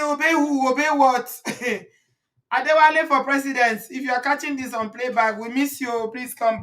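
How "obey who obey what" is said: lightly voiced scoffing, higher; mock defiance. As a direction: quote her scoffing again. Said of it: "Angela say".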